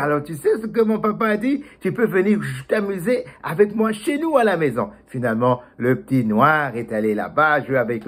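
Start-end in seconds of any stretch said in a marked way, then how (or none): 0.0-4.9 high, eager telling of good news; bursting to tell his friend; excited news. Acted. Mouse runs to tell friend.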